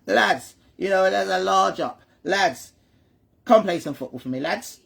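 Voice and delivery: Scottish impression gone Cockney; failed Scottish accent. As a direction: call the lads in botched Scottish. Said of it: "that's more Cockney, isn't it?".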